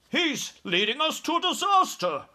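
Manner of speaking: very high-pitched, fearful outburst; panicked cry. A narrator's reading. Air Force chief distrusting the giant, terrified cry. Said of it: "He was shaking with fear".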